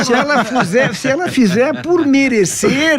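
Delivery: high, loud playful outburst; half-laughing tease at a friend's joke; excited joking. Real, not acted. Joking about lending wife the card.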